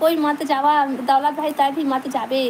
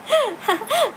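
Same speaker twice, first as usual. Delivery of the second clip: high-pitched giggle; real laugh; 8 semitones higher than usual. Real, not acted. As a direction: burst into a short, high giggle. Amused during live chat. (ऊँची खिलखिलाती हँसी)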